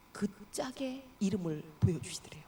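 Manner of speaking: hushed, breathy whisper; dropping to a whisper for the hidden name; whispered reveal. A narrator's reading. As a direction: lean in and whisper the secret.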